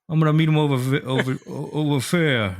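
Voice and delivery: loud, high caricature of a Brit; mock English accent. Mocking British th-as-F to his friends, exaggerated English accent. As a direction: stumble through a silly British accent. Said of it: "how stupid British people sound".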